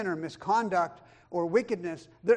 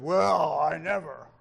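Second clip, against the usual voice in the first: louder, drawn-out mock indignation; mock self-righteous; 2 semitones lower, 6 dB louder, slower than usual. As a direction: put on a holier-than-thou huff. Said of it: "keeps us from the attitude of".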